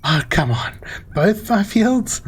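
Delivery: slow, high dismayed question; dismayed disbelief. Real, not acted. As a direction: drag it out in disbelief. Storm hit his crops.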